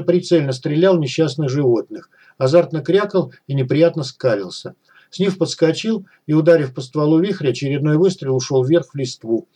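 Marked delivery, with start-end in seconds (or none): none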